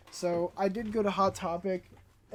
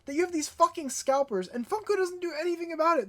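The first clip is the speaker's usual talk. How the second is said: raised angry voice, fast at the end; yelling; 7 semitones higher than usual. Real, not acted. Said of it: "I know I'm yelling".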